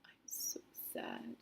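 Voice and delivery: breathy broken whisper, very low. Retold or imitated. Mom retelling crying to her son, whispered. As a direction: barely get the words out, whispering. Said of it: "and I said".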